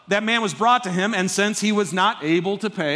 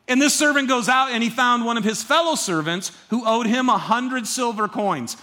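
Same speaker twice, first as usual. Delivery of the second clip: ordinary talk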